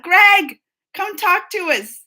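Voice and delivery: loud, high call to the next room. Pretend. Pretending to call her husband in to join the stream, loud and high. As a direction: call your husband over, playful. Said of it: "surprise him and be like".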